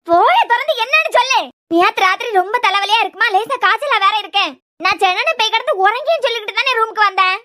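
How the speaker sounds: high, agitated tirade; angry scolding. Acted. Mother demanding why the door stayed shut, angry scolding. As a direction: berate her with mounting exasperation. Mother berates weeping daughter.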